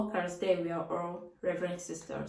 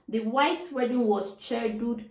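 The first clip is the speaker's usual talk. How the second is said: ordinary talk, nothing marked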